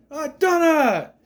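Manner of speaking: put-on high, slow, overjoyed old man's cry of her name; joyful cry. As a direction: call out her name as if moved to tears of joy. Wilfred greets Donna on return.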